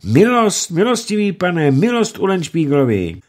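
high, loud pleading cries; crowd calling out. Acted. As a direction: call out for mercy.

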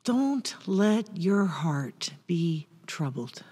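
slow breathy whisper. Acted. Jesus comforting anxious disciples, whispered slow. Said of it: "old King James Version".

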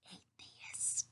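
hushed, whispered label; whispered aside. Acted. Aside to the listener about non-believers, whispered. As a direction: whisper it like a forbidden word.

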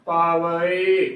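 ਭਾਵ ਏ slowly as "paa-va-e"; correct drawn-out reading. Shown as a demonstration. Teacher spelling out the word, ਭਾਵ ਏ as slow "paa-va-e". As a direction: give ਭਾਵ ਏ a separate, stretched final e. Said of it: "ਇਸ ਸ਼ਬਦ ਦਾ ਜੋ ਉਚਾਰਨ ਹੈ".

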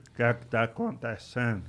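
Maguila's voice. Retold or imitated. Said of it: "vamos pedir calma, Maguila".

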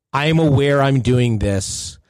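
ordinary delivery

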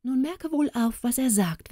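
lightly played storybook woman alerting the youth; woman calling over. A narrator's reading. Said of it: "Da rief die schöne Frau dem Jüngling zu".

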